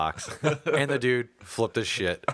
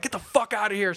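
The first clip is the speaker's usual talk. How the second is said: higher-pitched retold order, not truly shouted; mock yell; 8 semitones higher than usual. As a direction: imitate an angry coworker ordering someone out. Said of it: "Starts yelling at him".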